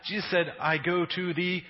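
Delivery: loud, impassioned sermon; full-force preaching. Acted. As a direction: drive the point home. Preaching climax to congregation.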